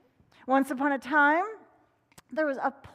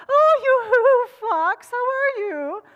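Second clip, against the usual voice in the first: much higher, louder hen; hen greeting; 12 semitones higher, 12 dB louder, much slower than usual. Acted. Hen greeting Fox, high and loud. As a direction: wave and sing out friendly. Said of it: "she waved".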